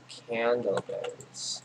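slow, savoured "Candleberries" with a falling end; drawn out. Real, not acted. Gamer reading a new item name, slow and drawn out. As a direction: stretch "Candleberries" out as you read it.

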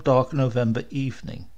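usual speaking voice

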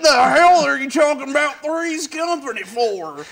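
mock viewer complaint. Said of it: "we're gonna get a lot of emails like".

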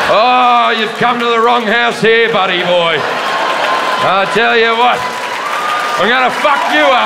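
hammed-up gun owner sizing up a burglar; mock tough threat.

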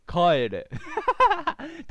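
loud command breaking into a laugh; commanding go back. Acted. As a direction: bark the sign's order then crack up. Reads sign as command.

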